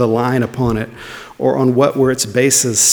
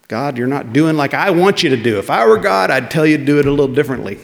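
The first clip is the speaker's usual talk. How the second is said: brash complainer; 3 semitones higher, 3 dB louder than usual. Retold or imitated. A believer bossing God, mock brash. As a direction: rattle off a cheeky demand to God. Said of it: "We would never say that that way".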